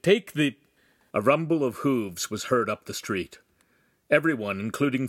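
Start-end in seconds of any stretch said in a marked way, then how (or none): 0.0-0.6 higher, louder urgent plea; pleading. A narrator's reading. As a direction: beg hurriedly, breaking off unfinished. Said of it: "Agostino pleaded".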